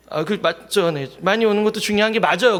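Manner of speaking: usual speaking voice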